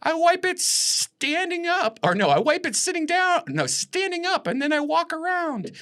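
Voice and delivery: very high, stretched-out comedian caricature; exaggerated high voice, drawing out the key words; Jerry Seinfeld. Comedian answers a silly audience question, high drawn-out impression. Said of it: "And he goes".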